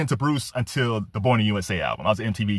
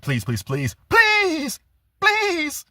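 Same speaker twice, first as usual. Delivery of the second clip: [name] please; much slower than usual. Retold or imitated. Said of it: "where he does, like".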